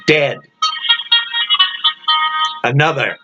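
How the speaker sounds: plain reading voice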